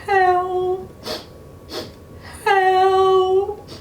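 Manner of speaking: high, mock-frightened calls; crying for help. A narrator's reading. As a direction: call out for help, scared. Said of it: "sat down on a rock and cried".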